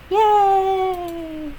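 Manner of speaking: high, bright little cheer; excited cheer. Acted. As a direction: cheer like a happy kid. Sayori cheers at a promise.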